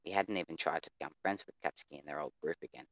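normal talking voice

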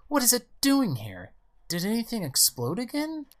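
high, agitated mother's questions; alarmed exclamation. Acted. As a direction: demand answers in a high, alarmed voice. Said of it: "exclaims Mother".

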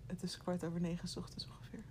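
fast, soft whispered aside. Real, not acted. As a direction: whisper it to the listener. Aside to listener.

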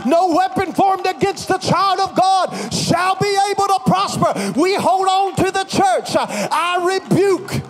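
shouted commanding rebuke; fierce commanding prayer. Acted. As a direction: rebuke it with full force.

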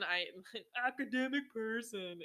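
laughing, self-mocking repeat of academic person; mock your old self, academic person with a laugh; mocking echo. Shown as a demonstration. To listeners, mocking her old belief with a laugh. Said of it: "that was, that was silly of me".

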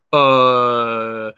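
a caught-out, stalling "uh"; guilty filler sound; caught-out hesitation. Retold or imitated. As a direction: stall like you've been caught. Retold awkward hesitation.

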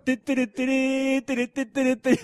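game sound imitation.

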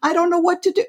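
the mind pleading it can't; whining mind. Acted. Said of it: "and the mind's like".

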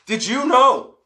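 high, loud game-show burst; sudden high shout. Acted. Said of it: "I don't know why I did that".

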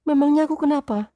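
high, loud, fast wife voice; high-pitched retort. A narrator's reading. Wife retorting sharply to husband in a high voice. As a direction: shoot back shrilly as the wife. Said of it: "jawabnya dengan nada tinggi".

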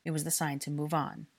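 ordinary delivery, nothing marked

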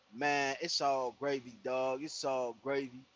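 a hint of a smooth stranger brushing it off; mild laid-back dude voice. Stranger calming him down.

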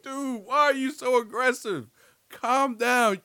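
disbelieving, scolding the character; incredulous scolding. Retold or imitated. Host scolding the stalker character, high and incredulous.

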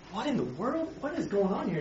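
hushed wonder at a strange sight; bewildered hush. Acted. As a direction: murmur it in disbelief. Moses reacting to burning bush.

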